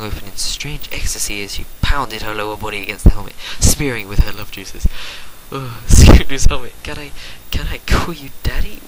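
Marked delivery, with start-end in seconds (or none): 5.5-8.8 louder, hammed-up breathy moan fading to whisper; mock moaning. Rainbow Dash caught with the helmet, mock moaning then whispering. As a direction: ham up the ecstasy.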